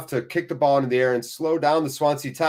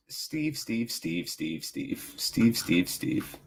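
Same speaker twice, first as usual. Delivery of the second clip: fading whisper; 3 semitones lower, 8 dB quieter, much slower than usual. Acted. Calling name, fading to whisper.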